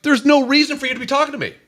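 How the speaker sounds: high, loud, incredulous objection; retold protest. Married man retelling his answer to the college girl, protesting. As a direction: push back in exaggerated disbelief.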